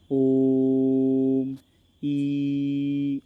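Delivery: ओम and ई each held long and steady; chant-like sustained ओम, ई; prolonged vowels. Shown as a demonstration. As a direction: stretch ओम then ई to triple length. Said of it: "एक स्वर का मैं तीन बार उच्चारण कर रहा हूँ".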